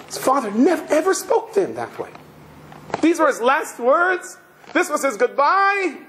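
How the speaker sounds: high-pitched, incredulous protest; retold shock. Son shocked by his father's last words, incredulous. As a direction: voice jumps up in disbelief, retelling the son's shock. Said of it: "Heschel was shocked".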